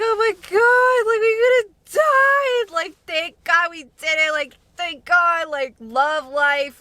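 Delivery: high, slow, tearful relief; mock the group's weepy gratitude at having survived; crying, shaken. Friends in the hotel after the crash, weepy and high. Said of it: "we're all crying".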